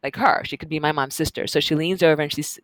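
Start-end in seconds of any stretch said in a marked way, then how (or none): none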